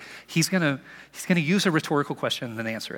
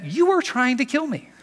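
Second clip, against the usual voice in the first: rising accusation; 10 semitones higher, 4 dB louder than usual. Retold or imitated. Jesus confronting accusers.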